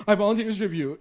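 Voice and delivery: loud, high mock proclamation; mock heroic declaration. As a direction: ham up a noble sacrifice. Mocking Archie's dramatic courtroom line.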